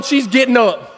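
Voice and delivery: ordinary delivery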